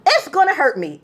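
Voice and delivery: usual speaking voice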